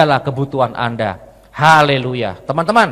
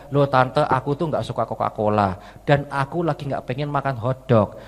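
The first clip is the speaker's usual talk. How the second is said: put-on soft, lower boyish objection; meek protest; 4 semitones lower, 6 dB quieter than usual. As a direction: object quietly to an auntie. Boy protests softly to auntie.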